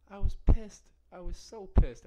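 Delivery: loud and high, reliving the rage; angry outburst. Retold or imitated. Telling podcast listeners about toothbrush fury, loud angry. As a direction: shout that you were pissed.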